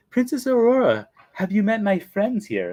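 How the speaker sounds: high, pointed nanny call; Mary Poppins. Retold or imitated. Nanny presenting her friends to the princess, high put-on voice. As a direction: summon her in a put-on prim voice. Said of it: "She goes".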